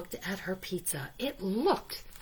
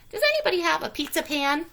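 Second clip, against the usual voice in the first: quick call out the window, higher; 8 semitones higher, 5 dB louder, faster than usual. A narrator's reading. Hen calling out the window, higher and quick. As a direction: call to the neighbours outside. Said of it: "she called".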